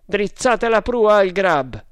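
high, loud, slow command; commanding order. A narrator's reading. Leader steering his crew to the ship, loud slow command. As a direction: call the order out deliberately.

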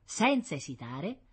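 ordinary delivery, nothing marked

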